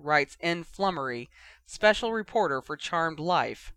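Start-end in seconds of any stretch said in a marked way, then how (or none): none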